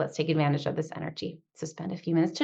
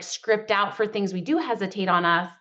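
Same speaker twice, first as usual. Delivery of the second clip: ordinary talk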